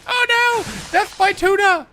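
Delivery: very high, loud mock wail; hammed-up despair. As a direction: wail like a melodrama victim. Hammed-up death in game.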